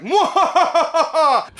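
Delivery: loud, high mock evil cackle; maniacal villain laugh. Okabe striking a pose, laughing maniacally. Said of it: "He's always laughing maniacally".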